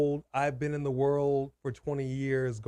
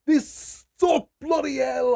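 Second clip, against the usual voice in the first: high, loud hammed-up British exclamation; mock British accent; 12 semitones higher, 7 dB louder, slower than usual. Host slips into a put-on British accent while ranting. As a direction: blurt it like a put-on Brit. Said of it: "I don't know what accent I'm doing right now".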